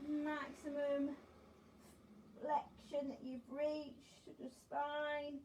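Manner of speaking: slow, drawn-out calm cues; slow guided stretch. Acted. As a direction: stretch each word out calmly.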